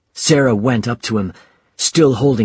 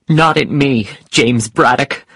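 raised, scolding cry; wife's indignant outburst; 4 semitones higher, 4 dB louder than usual. Acted. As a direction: tell your husband off sharply. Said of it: "she cried".

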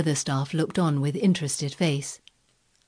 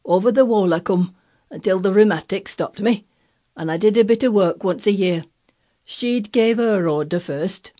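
put-on touch of stubborn old gardener, louder; grumpy obstinacy; 3 semitones higher, 6 dB louder than usual. A narrator's reading. Old gardener owning up to climbing in, obstinate. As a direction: keep it dogged and Yorkshire. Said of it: "with grumpy obstinacy".

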